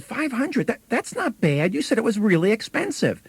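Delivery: high, lifted pitch, relieved at the price; pleasant surprise. Retold or imitated. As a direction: sound pleasantly caught off guard. Sister surprised by low price.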